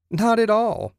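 high, eager exclamation; exclaiming. A narrator's reading. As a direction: leap up in pitch to defend his car. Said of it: "Ted exclaimed".

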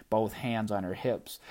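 ordinary delivery, nothing marked

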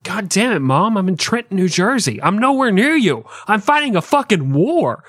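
exasperated son, pitched high; hammed-up fed-up protest to a nagging mom; high, whiny mock outburst; George Washington. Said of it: "And he's like".

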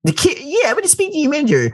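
high, entitled complaining voice; Karen voice. Pretend. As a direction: demand the manager, high-pitched. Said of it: "start doing the Karen voice".

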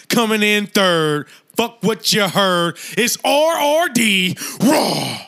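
slow, loud hype intro. Acted. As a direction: announce yourself like a ring entrance. Podcast intro.

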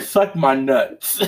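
slow, laughing retort in a changed voice; laughing insult. Retold or imitated. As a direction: spit it out while cracking up. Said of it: "I was like".

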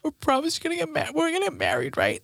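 high, hurried, needy babble; whiny high-pitched plea for reassurance; mock tearful pleading. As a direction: imitate yourself begging through tears. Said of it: "This is me. Disgusting".

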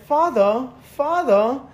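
put-on a child calling out in an affected English accent; mock English-accented "father", questioning lilt; weird accent. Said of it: "a weird accent".

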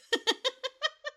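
giggling high squeal; high laugh. Real, not acted. Laughing at herself.

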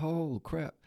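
sudden high yelp of surprise; startled oh crap; surprised. Retold or imitated. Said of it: "every time I'm like".

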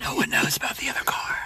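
fast, secretive whisper. Pretend. Secretive joke.

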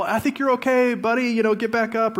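high, light parent voice; reassuring parent. Acted. Parent to a buddy who fell, high light encouragement. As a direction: coax the kid up in a high voice. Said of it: "if it's that whole".

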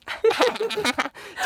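high maniacal cackle; unhinged villain. Real, not acted. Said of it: "That's my maniacal laugh".

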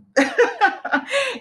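a high, bright burst of laughter at herself; embarrassed laugh. Real, not acted. Confessing she is a pack rat, laughing at herself.

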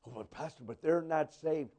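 very soft, low protest; objecting believer. Retold or imitated. Believer objecting to the pastor, soft and low. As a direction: whisper the objection timidly.

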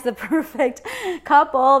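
normal talking voice

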